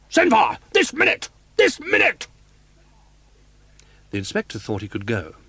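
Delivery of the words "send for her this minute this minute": very high, loud shouted commands; furious shout. A narrator's reading. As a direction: scream the order, beside himself. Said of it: "he shouted".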